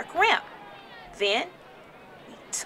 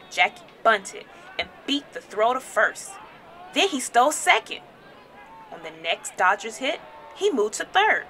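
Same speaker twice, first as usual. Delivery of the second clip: ordinary delivery, nothing marked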